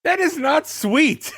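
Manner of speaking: high, loud, drawn-out objection; incredulous protest. Real, not acted. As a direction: shout it in disbelief. Arguing over a TV scene.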